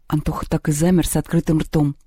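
ordinary delivery, nothing marked

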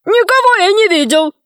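high, panicky protest; cornered fibber's high cry; frantic denial. A narrator's reading. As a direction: deny it in a high voice. Cannibal frantically denies it.

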